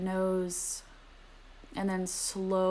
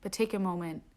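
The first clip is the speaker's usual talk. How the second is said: softer meditation voice sinking to whispers; guided meditation; 7 dB quieter than usual. Acted. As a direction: guide the breath, letting phrases fade to a whisper. Returns to guided breathing.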